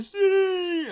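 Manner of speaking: very high, loud insistent cry; old man. Retold or imitated. Old man in a cave insisting, very high loud cry. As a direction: shriek it as the hammed-up hermit. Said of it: "il y a un vieux qui m'a fait".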